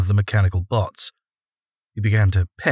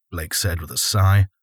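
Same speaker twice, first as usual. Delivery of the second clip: ordinary delivery, nothing marked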